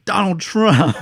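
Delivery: retold shout, half-laughing bravado; imitating shouting. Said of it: "like, shouting".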